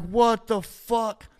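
high, loud, drawn-out outburst; acted shocked disbelief. Man reliving being violently sick, acted shocked disbelief. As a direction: retell your disbelief, stretching the words out.

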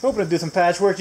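usual speaking voice

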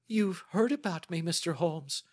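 high, startled lady client; astonished cry. Acted. Startled client to the detective who read her, crying out astonished. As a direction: cry out in amazement, pitch leaping up. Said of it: "she cried".

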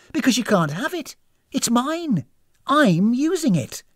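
high, loud, slowing to emphatic finish; possessive, indignant. Acted. Guest guarding her skin from hostess, indignant. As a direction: refuse crossly, hitting each phrase.

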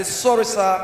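low, humbled apology; apologetic officer. Acted. Immigration officer to Nigerian passport holder, low humble apology. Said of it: "when I see immigration officer apologize".

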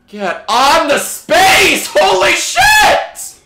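high, loud, slow yell of disbelief; furious shout. Real, not acted. Gamer yelling at teammates to get on the space, furious shout. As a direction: roar the order, then explode.

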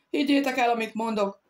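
loud, high shout cutting into the chatter. Acted. Ruiz shouts over his companions to make them listen. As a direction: yell it over the others. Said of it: "kiabált bele ismét Ruiz Soeiro".

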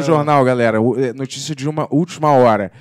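loud, high mock newsboy proclamation; breaking-news crier. Announcing a partner's verdict as breaking news, mock proclamation. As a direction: shout it out like a stop-the-press bulletin.

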